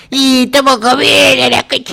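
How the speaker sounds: overdone Argentine impersonation; exaggerated Argentine accent. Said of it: "que exagera su argentino".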